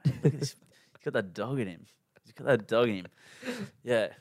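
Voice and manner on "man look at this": hushed admiring whisper; breathy whispered praise. Real, not acted. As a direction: whisper it like a secret tribute. Teasing admiration of friend.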